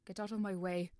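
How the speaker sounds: snapped. Acted. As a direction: bark it at him. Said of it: "Wilbur snapped".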